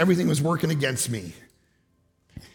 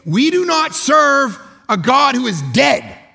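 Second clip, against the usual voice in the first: full-force preaching; 8 semitones higher, 6 dB louder than usual. Acted. Preacher proclaims a living God to the church, shouting. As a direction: preach it out to the back row.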